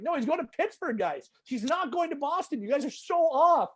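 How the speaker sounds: high, mocking singsong tease; high, amused taunt; teasing PR guys. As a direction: needle the reporters who got it wrong. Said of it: "they were just laughing at everybody 'cause they're like".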